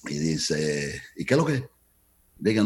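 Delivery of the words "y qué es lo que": put-on Cuban accent. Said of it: "con el acento cubano".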